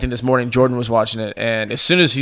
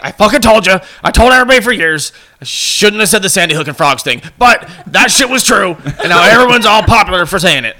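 loud, higher ranting Alex Jones voice; mock Alex Jones rant, louder and higher; Alex Jones impression; 5 semitones higher, 4 dB louder than usual. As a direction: rant like a smug conspiracy host, laughing by the end. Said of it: "you know that guy's like".

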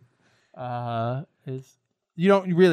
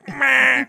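loud, high silly bleat; nonsense-language "meh"; 11 semitones higher, 6 dB louder than usual. Pretend. Host speaking his new language, silly "meh". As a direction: blurt it high and loud, like a noise. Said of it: "It's my new language".

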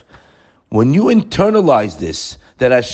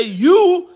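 "you" hit much higher and louder; stress on you; 5 dB louder than usual. Shown as a demonstration. Preacher to congregation, leaping up on "you". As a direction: punch "you" as the key word.

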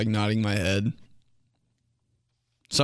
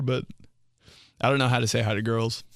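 ordinary talk

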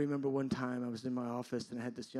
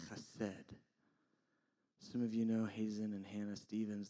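ordinary delivery

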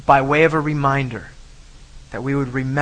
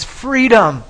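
raised, higher, drawn-out mock battle cry; a moderate imitation of a defiant yell; retold yell; 7 semitones higher, 6 dB louder than usual. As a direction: cry it out like a retold last shout, short of full force. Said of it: "he yells at the top of his lungs".

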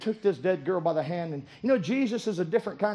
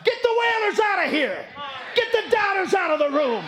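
higher, louder commanding preaching; preaching at full force; 10 semitones higher, 4 dB louder than usual. Acted. Preacher commanding doubters out of the room, full-force preaching. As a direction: drive it home from the pulpit.

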